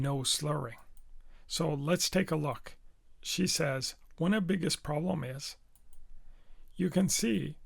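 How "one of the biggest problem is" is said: rushed, dropping "the" and the final S of "problem"; omitted words, sounds. Shown as a demonstration. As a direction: swallow the small words in "One of the biggest problem is". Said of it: "she's omitted the word the".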